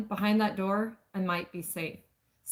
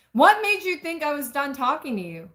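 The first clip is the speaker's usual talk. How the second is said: sharper, higher retake with edge; redone angry demand; 6 semitones higher, 4 dB louder than usual. Acted. Narrator rereads the man's demand to her, more heated. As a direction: push the anger harder this time. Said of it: "Let me make this right".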